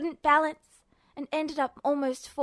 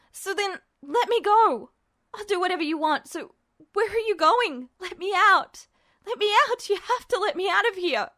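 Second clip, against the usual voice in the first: high, loud desperate begging; frantic pleading; 5 semitones higher, 4 dB louder than usual. Acted. Ed begs Justin to release him, frantic. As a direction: beg to be let out.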